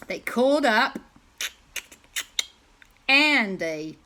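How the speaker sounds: put-on American accent. A narrator's reading. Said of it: "did it in an American accent".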